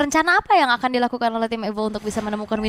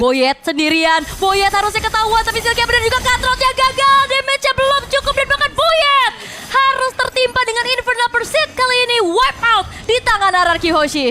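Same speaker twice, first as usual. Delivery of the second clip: high, loud hype shout; excited play-by-play; 11 semitones higher, 6 dB louder than usual. Real, not acted. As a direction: yell the kill. Caster calls a team wipe.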